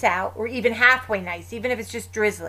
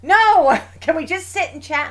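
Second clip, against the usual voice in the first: higher and louder, an imitated exasperated sister; mock exasperated outburst; 7 semitones higher, 8 dB louder than usual. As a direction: squeal it like a sister begging not to walk. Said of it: "She'll be like".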